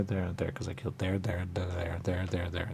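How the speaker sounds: soft unvoiced whisper. Real, not acted. Admitting where he killed, in a whisper. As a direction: breathe the words out in a whisper.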